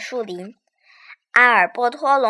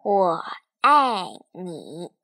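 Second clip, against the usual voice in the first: tender; 5 semitones lower, much slower than usual. Acted. Last tender words.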